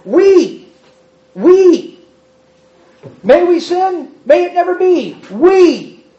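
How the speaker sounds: "We" hit high and loud; stress on we. Shown as a demonstration. Preacher showing the Greek emphasis, loud high stressed we. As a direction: punch "We" like a drumbeat. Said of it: "It starts with "we".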